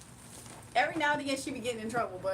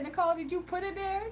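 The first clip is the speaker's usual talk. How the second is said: quick teasing question; 6 semitones higher, faster than usual. Retold or imitated. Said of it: "I said".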